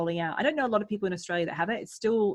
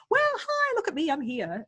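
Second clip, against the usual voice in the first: a sudden, much higher gushing hi; sending up a chirpy show-off going live; hammed-up look-at-me greeting; mock Facebook live host; 9 semitones higher than usual. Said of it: "on Facebook, you go live, go".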